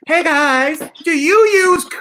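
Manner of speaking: high, loud salesman voice; mock pitchman. Host doing a cheesy pitchman voice for a fake coupon ad. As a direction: sell it like a cheesy ad.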